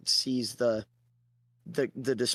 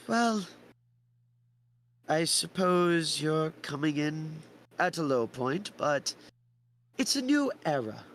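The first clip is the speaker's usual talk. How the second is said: higher, slow elder; weary old man, pitched up; old man admits hard times, higher voice; old villager; 4 semitones higher, slower than usual. Acted. Old man answers Theodore.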